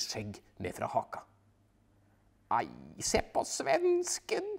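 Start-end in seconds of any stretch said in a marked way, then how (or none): 2.6-4.5 much higher, slow taunting voice; wife mocking the Swedish skier. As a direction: gloat at the Swede. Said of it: "sier Paprika".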